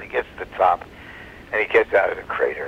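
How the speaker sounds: ordinary talk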